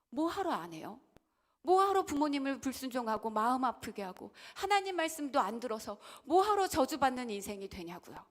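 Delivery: loud, rising sermon rebuke; preaching at full force. Acted. Preacher rebuking the congregation, loud and urgent. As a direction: press the congregation hard.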